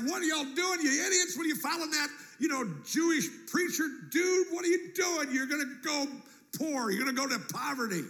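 high, loud, scolding father; angry dad. Retold or imitated. Fishing dad scolding sons for following Jesus. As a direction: yell at the sons who left. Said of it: "Dad's like".

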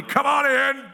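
high, loud grumpy "Come on in"; mock surly granny shouting from the couch; surly grandma. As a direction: call it out like a grumpy old woman. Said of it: "you hear a surly".

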